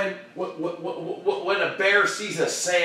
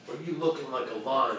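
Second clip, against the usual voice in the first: low, soft, falling question; lion question; 5 semitones lower, 4 dB quieter than usual. Acted. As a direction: drop the voice for LION. Lion against the gazelle.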